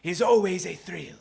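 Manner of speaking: high, put-on old man voice; gleeful old shoemaker. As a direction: relish it as a grinning old shopkeeper. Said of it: "The shoemaker grins and says".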